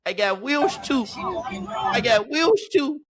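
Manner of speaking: high, drawn-out mock brag; hyped-up imitation of a cocky quarterback; playful imitated boast. Voicing quarterback's boast, stretched out.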